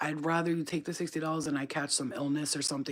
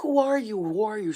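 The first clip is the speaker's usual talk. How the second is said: loud, high frantic demand; re-enacted screamed question, dropping in pitch on the repeat; imitated scream; 6 semitones higher, 8 dB louder than usual. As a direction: cry out like a panicked stranger. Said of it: "She's screaming".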